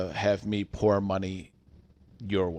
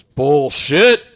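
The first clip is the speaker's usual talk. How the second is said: retold customer's booming, higher-pitched shout; loud outburst; 4 semitones higher, 12 dB louder than usual. Customer rejecting the clerk's offer, loud outburst. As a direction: belt it out, mimicking an outraged customer. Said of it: "just so loud".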